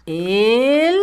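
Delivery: drawn-out dictation, word by word; dictating. Shown as a demonstration. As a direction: stretch the word as you write it. Dicta mientras escribe. (dictando, alargando la palabra)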